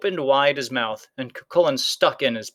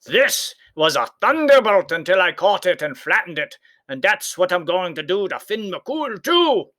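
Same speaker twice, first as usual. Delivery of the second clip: giant bragging; 6 semitones higher, 3 dB louder than usual. A narrator's reading. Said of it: "Cuchulainn said".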